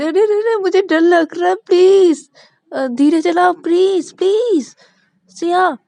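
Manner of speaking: high, loud stammering panic; terrified shriek. Acted. Shruti shrieks at Shreya to stop speeding, terrified. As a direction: shriek at the speeding driver in terror.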